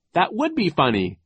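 high, loud, put-on merriment; falsely jolly; feigned amusement. A narrator's reading. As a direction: pretend to find it funny. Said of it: "he lied".

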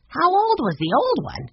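put-on very high child's voice, full of wonder; curious young boy. A narrator's reading. Benny asking Henry about the old woodshed, amazed. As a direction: ask it high and wide-eyed.

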